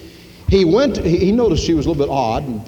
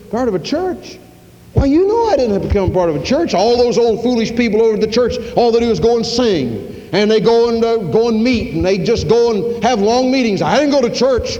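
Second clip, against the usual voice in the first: high, quick scoffing voice; a self-satisfied man brushing off church, higher and faster; dismissive church-skipper; 6 semitones higher than usual. Acted. Careless churchgoer defends himself.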